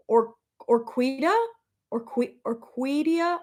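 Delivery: Orquídea said haltingly, unsure of the Spanish sounds; unsure pronunciation. Real, not acted. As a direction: stumble over Orquídea, trying twice. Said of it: "I butchered that so bad".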